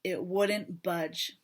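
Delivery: ordinary delivery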